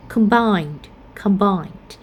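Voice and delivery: combined stressed on BINED, falling; stress on second syllable. Shown as a demonstration. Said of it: "second syllable will have a primary stress".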